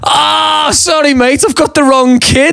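high-pitched, hammed-up "oops" cry; mock embarrassed apology. Stranger realises he has the wrong lad, high comic apology. Punchline, guy realises his mistake.

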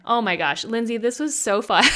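normal talking voice

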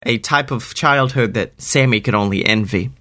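usual speaking voice

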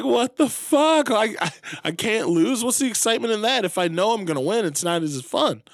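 high, loud mock outrage; hammed-up exasperation, easing toward the end; exasperated gambler. Acting gambler's exasperated complaint.

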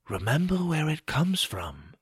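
soft, distant, solemn goddess; Mother Moon. A narrator's reading. Mother Moon foretells to the boy, solemnly and softly. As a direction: hush it like an oracle.

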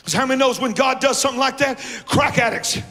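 high, insistent pulpit shout; full-force preaching. Acted. Preacher urges church to God's house, shouted preaching.

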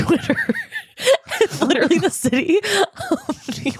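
words breaking into laughter; laughing. Real, not acted. Telling a friend about the flooded city, laughing. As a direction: giggle through the line, pitch jumping up.

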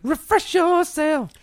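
high, slow drawn-out goofy voice; silly high voice. Pretend. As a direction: ham it up in a squeaky voice. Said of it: "You've got to make him".

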